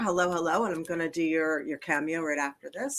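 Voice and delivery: ordinary talk, nothing marked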